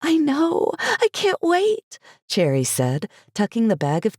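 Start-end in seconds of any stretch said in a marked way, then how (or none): 0.0-2.0 very high, slow squeal; excited. Acted. Cherry voiced in story.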